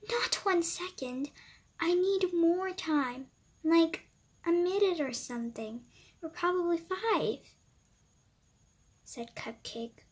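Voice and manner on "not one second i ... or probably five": higher, eager bargaining; small pleading girl voice. Acted. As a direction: haggle for minutes like a kid. Cupcake begs for more time.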